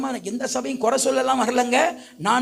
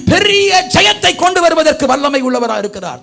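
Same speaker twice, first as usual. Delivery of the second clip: loud, raised, driving sermon delivery; full-force preaching; 5 semitones higher, 7 dB louder than usual. Real, not acted. Preacher telling the congregation of victory through unity, full-force preaching.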